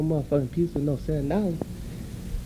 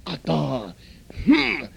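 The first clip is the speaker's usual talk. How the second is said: furious customer; 9 semitones higher than usual. Acted. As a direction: threaten the owner, fully worked up. Customer threatens the owner.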